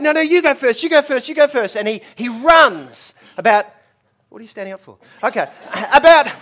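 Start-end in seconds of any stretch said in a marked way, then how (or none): 0.0-1.7 mock argument. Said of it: "fighting and arguing with each other".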